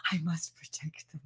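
secretive whispered aside. Acted. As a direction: whisper it like a conspiracy. Secret chakra confession.

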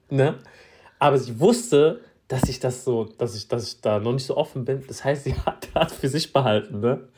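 ordinary delivery

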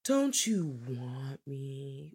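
slow, soft, seductive movie line; seductive quote. Retold or imitated. Quoting the seductress trying to seduce him, slow and soft. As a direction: breathe it like a temptress. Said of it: "a line that I quote from this movie".

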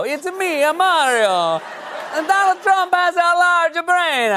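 put-on high, slow hammy Italian accent; bad Italian accent. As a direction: do a cartoon Mario in Italian accent. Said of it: "a bad Italian accent".

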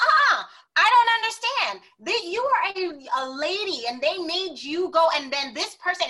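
loud, high-pitched mock protest; imitated livid boyfriend. Boyfriend arguing she was made to go elsewhere, imitated livid. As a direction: object indignantly in his voice, high and loud. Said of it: "and he was livid".

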